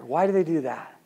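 ordinary talk

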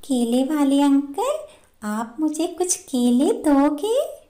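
high, rising bird voice, polite request; विनती करती चिड़िया. Acted. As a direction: ask uncle for a favour in a high voice. Bird asks banana seller for bananas. (ऊँची आवाज़ में uncle से विनती)

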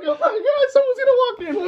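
very high falsetto fretting; mock nervous squeal. Afraid someone's gonna walk in, mock nervous squeal. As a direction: panic in a tiny hammed-up squeal. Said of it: "like, so nervous".

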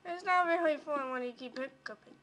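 usual speaking voice

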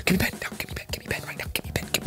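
harsh whispered demands, no voice; pushy parent whispering. Retold or imitated. Parent on the phone demanding a pen, aggressive whisper. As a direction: hiss the orders like a parent on a call. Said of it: "they do it so aggressively".

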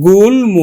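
গুল্ম with a clearly sounded m after l; correct pronunciation. Shown as a demonstration. Teacher giving students the real reading, গুল্ম with audible m. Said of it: "আসলে উচ্চারণ হবে".

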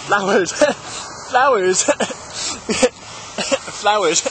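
"Flowers" lisped between giggles; mock lisp. Vlogger amusing self for the audience, giggly lisped "Flowers". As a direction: lisp the word "Flowers", cracking up each time. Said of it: "I will never do the lisp again".

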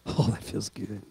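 low, soft, blissful murmur; contented sigh of comfort. Pretend. Pastor acting out sitting on heated seats, blissful murmur. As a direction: melt into the warm seat. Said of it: "And I'm gonna go".

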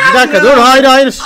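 loud stunned protest; shouting in disbelief. Real, not acted. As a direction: yell no in shock. Losing the auction, dismay. (yüksek sesle şaşkın itiraz)

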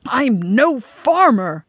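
slow, loud, choked protest. A narrator's reading. Emain is sent to farm on Pover, choked. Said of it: "said Emain in a choked voice".

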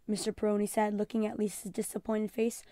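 plain reading voice

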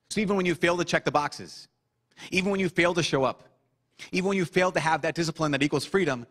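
rising pulpit fervor, punching each even; preaching at full force. Acted. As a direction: build the appeal like a revival preacher. Preacher builds a rising appeal.

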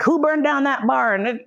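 high, slow demanding question; acted interrogation. Interrogator questions captive about the barn, high drawn-out demand. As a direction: draw out the question as a mock interrogator.

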